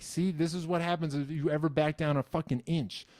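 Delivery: ordinary delivery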